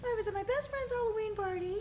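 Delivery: high, squeaky rainbow character voice. Pretend. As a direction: retell it as a perky rainbow. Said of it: "You're not talking like a rainbow".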